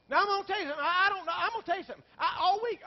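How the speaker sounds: high, loud preacher's cry; preaching at full force. Acted. Pastor tells congregation his despair, loud preaching cry. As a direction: lift the congregation with it.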